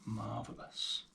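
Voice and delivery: ordinary talk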